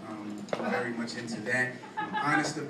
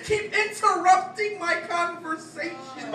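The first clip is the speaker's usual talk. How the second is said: higher, louder, rhythmic slam-poem delivery; spoken-word poetry; 7 dB louder than usual. Acted. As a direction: perform it as a punchy stage poem.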